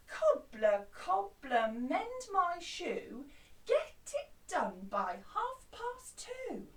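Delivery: a touch bossy, slow and firm; mildly demanding, drawn out; bit bossy. Shown as a demonstration. Customer pushing the cobbler for a deadline, a touch bossy. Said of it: "they're being a bit bossy".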